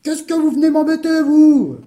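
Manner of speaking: high, loud angry yell; furious outburst. Acted. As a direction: yell at a pest in a rage. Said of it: "parut frapper de folie furieuse".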